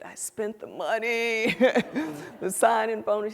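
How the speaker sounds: embarrassed confession broken by a laugh; young lawyer confessing she spent the bonus; sheepish laugh. Retold or imitated. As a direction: admit it with a guilty giggle.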